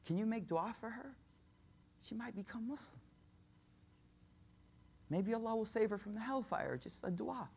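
soft, hesitant request with long pauses; touching plea. Retold or imitated. Son asking the Prophet to pray for his mother, tender plea. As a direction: plead gently, the softness growing as the pitch lifts. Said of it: "in this really touching moment".

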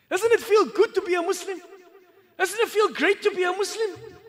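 high, loud joyful question; excited. Real, not acted. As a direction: rouse the crowd with joy. Rousing question to audience.

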